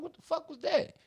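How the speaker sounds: high-pitched startled mock exclamation; startled reaction. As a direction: blurt it out high, acting spooked. Acting out being startled in bushes.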